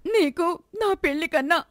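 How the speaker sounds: a daughter confronting her gambling father; accusing daughter. Acted. Daughter bitter.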